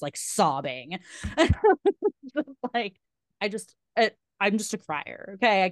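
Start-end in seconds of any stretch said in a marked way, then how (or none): 1.0-2.3 high, loud burst of self-conscious laughter; embarrassed laugh. Real, not acted. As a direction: laugh at yourself, a bit sheepish. Laughs at herself crying.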